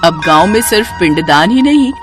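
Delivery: ordinary delivery, nothing marked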